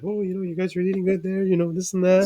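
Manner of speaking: high, hushed imitation of mom; mom's voice. Son mimicking his mom commenting on his eating, high and quiet. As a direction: put on a soft, high-pitched motherly voice. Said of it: "and she's like".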